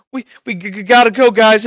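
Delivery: urgent stammer. Acted. Kilroy urges party out of fire.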